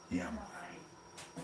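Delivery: breathy unvoiced whisper; sly whispered aside. Real, not acted. Sly aside after cupboard joke.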